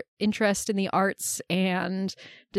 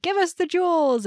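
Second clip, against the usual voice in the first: gangsters' demand; 8 semitones higher than usual. Retold or imitated. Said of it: "the gangsters are like".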